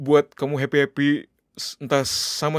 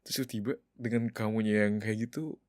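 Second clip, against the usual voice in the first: much softer, lower, disappointed; hurt and quiet; 3 semitones lower, 4 dB quieter than usual. Acted. Husband telling his wife how let down he feels, softly hurt.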